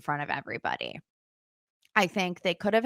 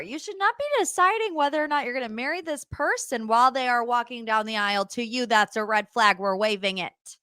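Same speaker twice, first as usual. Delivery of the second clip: ordinary talk, nothing marked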